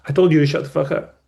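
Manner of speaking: quick annoyed comeback from a client; mock angry client. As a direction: snap back at the therapist, hurried. Client snaps back at therapist.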